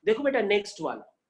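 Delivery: ordinary delivery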